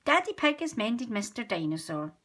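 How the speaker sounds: ordinary delivery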